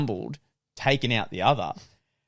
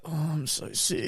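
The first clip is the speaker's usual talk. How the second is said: fake sick voice; 5 semitones higher, 8 dB quieter than usual. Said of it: "You're like".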